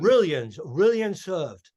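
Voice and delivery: loud, slowing mock Chinese accent. Comic parodying a fast-food slogan in China, mock Chinese accent. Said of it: "you can't do an accent".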